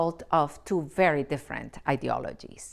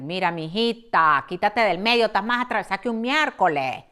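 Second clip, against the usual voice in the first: brusque scolding; 3 semitones higher, 6 dB louder than usual. Retold or imitated. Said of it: "they go like".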